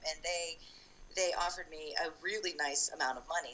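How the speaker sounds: usual speaking voice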